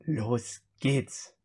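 normal talking voice

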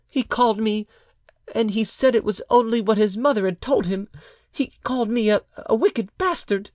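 put-on high-pitched little boy's voice; child. A narrator's reading. Child tells his mother the insult, in a child's voice. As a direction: report it high, as a hurt child.

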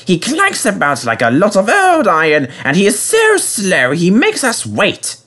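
high-pitched, whiny complaint; put-on voice of a peevish engine; grumbling. A narrator's reading. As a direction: moan with exaggerated exasperation. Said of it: "he grumbled to the others".

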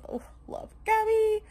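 excited squeal. Real, not acted. Squeal for friend.

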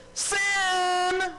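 sudden high shout of "Sin!"; yelled Sin!. Shown as a demonstration. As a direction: yell "Sin!" like an archer missing the target. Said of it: "I would yell something like this".

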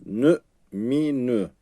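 Nü, mi, nü stopped abruptly with no lengthening; cut short. Shown as a demonstration. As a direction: snip each syllable like scissors on a thread. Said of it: "Hemen kesiyorum".

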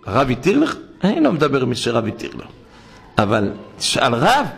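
ordinary talk

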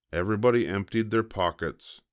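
ordinary delivery

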